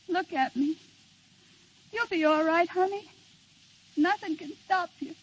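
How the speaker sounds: usual speaking voice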